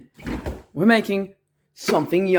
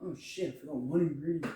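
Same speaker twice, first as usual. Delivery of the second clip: usual speaking voice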